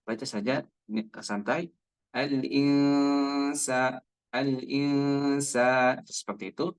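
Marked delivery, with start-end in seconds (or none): 2.1-6.0 al-insa with the nasal n held two beats, slow; held ikhfa. Shown as a demonstration. Teacher demonstrates ikhfa to student. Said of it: "ditahan suaranya dua harokat".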